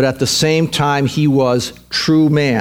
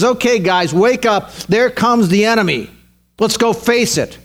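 resolute call to action; 7 semitones higher than usual. Shown as a demonstration. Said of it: "do you see the change?".

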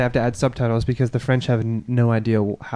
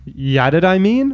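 "Ya Did I Mean?" slow, higher, louder, rising at the end; mock Bay Area slang; 6 semitones higher, 4 dB louder, slower than usual. Friend parrots the Bay Area phrase, slow and rising.

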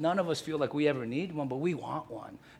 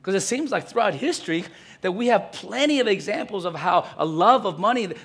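preaching at full force; 4 semitones higher, 7 dB louder than usual. Acted. Preaching raises intensity.

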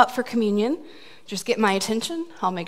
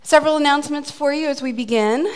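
normal talking voice

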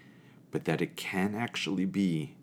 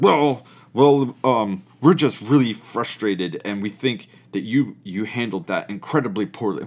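loud, higher, indignant complaint imitated; hammed-up grievance from an upset kid; mock frustrated scolding; 4 semitones higher, 9 dB louder than usual. Campers telling Uncle John he handled it poorly, loud mock indignation.

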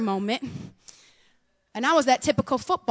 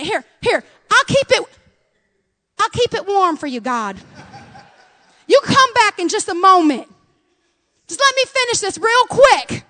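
mock placating; 7 semitones higher, 4 dB louder than usual. Mock brushing God aside.

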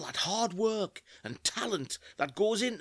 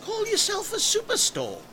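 indignant; 14 semitones higher than usual. Acted. Indignant at no nuts.

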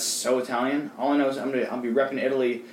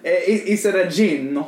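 higher, hammed-up Italian accent; mock Italian accent; 4 semitones higher, 3 dB louder than usual. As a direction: play up a cartoon Italian accent. Mock Italian version of Gene.

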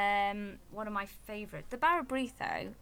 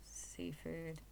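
whispered; 2 semitones lower, 9 dB quieter than usual. Real, not acted. Aside to herself.

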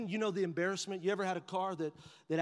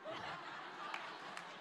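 breathy laugh; 14 dB quieter than usual. Real, not acted. Laughing at own joke.

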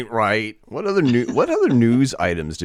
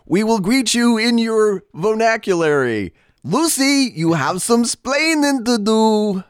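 alien greeter mimicking Ricky; 13 semitones higher than usual. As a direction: announce it like an alien ambassador. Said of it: "the aliens ... say".

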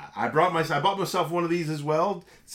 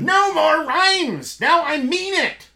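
much higher, louder, stretched furious yell; Vizzini yell; 11 semitones higher, 6 dB louder, much slower than usual. Acted. Vizzini screaming at Fezzik to stop rhyming, high and loud.

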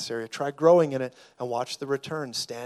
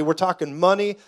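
ordinary talk

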